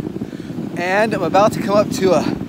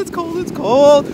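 higher, slightly louder cold complaint; repeated cold complaint; 6 semitones higher, 4 dB louder than usual. Real, not acted. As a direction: keep yelping about the cold.

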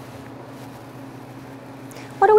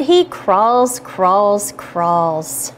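fading away; 5 semitones lower, much slower than usual. Acted. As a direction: let it trail off. Rhyme repeats, fading out.

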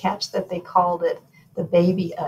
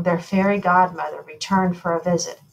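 ordinary delivery

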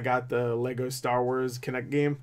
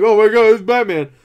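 panicked yelp, higher and louder; mock terror at spotting Batman; freaked-out gamer; 7 semitones higher, 12 dB louder than usual. Player imagines survivors spotting Batman, freaked out. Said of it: "they're all fucking freaked out".

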